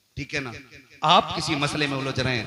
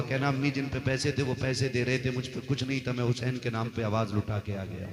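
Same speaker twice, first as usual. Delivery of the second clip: ordinary delivery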